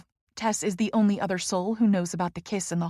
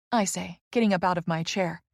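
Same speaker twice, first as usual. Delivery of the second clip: usual speaking voice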